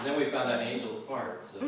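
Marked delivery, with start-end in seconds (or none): none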